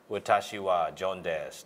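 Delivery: 「私はジョンです」をゆっくり英語母音寄りで; ゆっくり英語話者の口で言う; ネイティブ風の日本語. Shown as a demonstration. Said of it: "日本語の音に近い音を出されていて". (英語ネイティブ風の発音)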